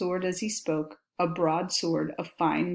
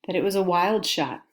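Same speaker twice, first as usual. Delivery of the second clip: plain reading voice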